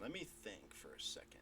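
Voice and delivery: barely voiced, thinking it over; whispered aside. Real, not acted. Game master muttering while deciding, whispered. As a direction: whisper it to yourself.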